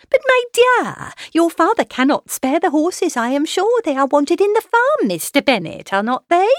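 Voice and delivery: high, fussy wife pressing her point; insistent mother. A narrator's reading. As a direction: insist loudly, pitch right up, then appeal to husband. Mrs Bennet presses her husband.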